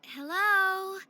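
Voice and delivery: loud call into an empty room. Acted. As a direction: call out to someone you can't see. Calling out to find Dawn.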